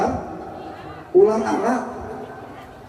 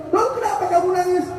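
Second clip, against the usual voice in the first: higher, quicker pressing question; insistent concerned Prophet; 7 semitones higher, faster than usual. Acted. The Prophet insists on knowing why Abu Bakar cries. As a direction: press him once more, worried. Prophet asks a third time.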